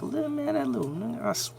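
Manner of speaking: high, hushed shocked gasp of disbelief. Retold or imitated. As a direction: caught off guard, half under the breath. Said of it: "And you're like".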